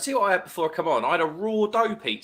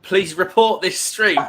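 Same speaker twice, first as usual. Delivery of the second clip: slow and loud, bursting into a laugh; deadpan then crack up; joking then laughing; 4 semitones higher, 9 dB louder, slower than usual. Real, not acted. Reading a cheeky viewer comment, then laughing.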